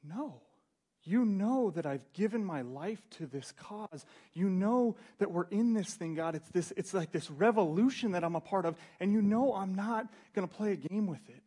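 high, loud passionate pleading; impassioned protest. Acted. Peter protesting to God, high and impassioned. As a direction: plead your loyalty with fire. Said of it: "And Peter's going".

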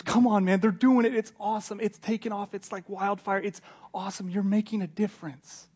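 high, eager pep talk; excited encouragement. Acted. Timothy urges Paul that he matters, excited encouragement.

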